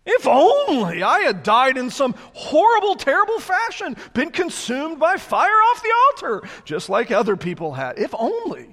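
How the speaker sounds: high, loud hammed-up despair; mock tragic whining people; melodramatic lament. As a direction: overplay the longing to have perished. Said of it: "How melodramatic is that?".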